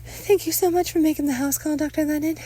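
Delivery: high-pitched motherly thanks; grateful mother. Acted. Mother thanks doctor for house call, high grateful voice. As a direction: thank him effusively, pitched well up.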